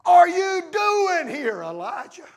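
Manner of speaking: high, loud, drawn-out exasperated demand; God's frustration. Acted. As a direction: stretch it out, then drop on the name. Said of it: "You see the frustration?".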